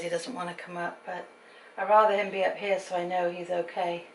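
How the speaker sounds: ordinary talk, nothing marked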